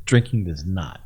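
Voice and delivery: imitating ex-girlfriend. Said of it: "my ex-girlfriend".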